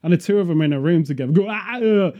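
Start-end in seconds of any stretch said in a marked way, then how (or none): 1.5-2.1 mock Austrian "Ah, uh" vowels, drawn out; Austrian vowel drill. Two Austrians practising vowels, mock Austrian "Ah, uh". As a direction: sound out "Ah, uh" like an Austrian accent coach. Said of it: "that's how they do their vowels".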